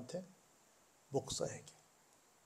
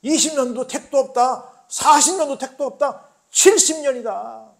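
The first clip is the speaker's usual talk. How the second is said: loud, high anguished proclamation; prophet's tearful cry, building to full force; 10 semitones higher, 16 dB louder, slower than usual. Acted. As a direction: Jeremiah crying out his warning. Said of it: "에레미야는 눈물로 말합니다".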